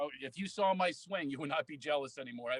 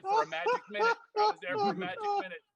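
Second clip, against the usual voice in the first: high excited; 5 dB louder, faster than usual. Real, not acted. Joking self-deprecation.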